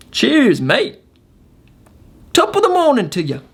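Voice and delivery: put-on an amateur's playful stab at a British accent; attempted British accent. Signing off to his viewers in a mock British accent. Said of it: "That was my attempt at a British accent".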